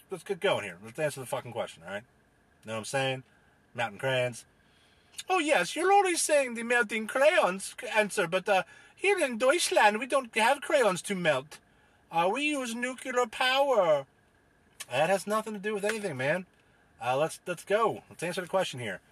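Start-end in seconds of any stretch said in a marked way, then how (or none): 5.3-14.0 high, swooping fake German accent; bad German accent. German panelist rebuts crayon talk, bad German accent. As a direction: protest in a hammed-up German accent. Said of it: "with your bad German accent".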